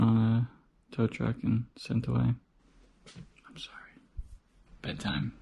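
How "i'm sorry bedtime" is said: quiet at night. Real, not acted. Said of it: "I'm whispering because it's very late".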